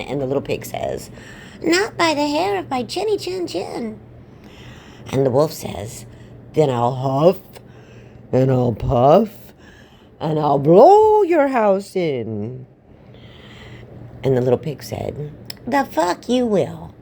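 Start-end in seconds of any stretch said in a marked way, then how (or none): 1.6-4.0 high, squeaky little pig voice; high-voiced storybook piglet refusing. Little pig answers the wolf. 6.5-12.7 big, slow, booming wolf threat; storybook big bad wolf, loud and drawn-out; threatening wolf. 15.7-16.9 high, slow squeaky pig swearing; cartoon piglet talking back; defiant little pig. Pig defies the wolf.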